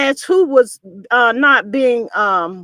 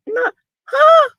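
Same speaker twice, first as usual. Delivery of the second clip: squeaky high-pitched not her; shocked disbelief; 14 semitones higher than usual. Retold or imitated. Reacting to the guest's shot, high shocked squeak. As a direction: jump way up in disbelief. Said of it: "I said".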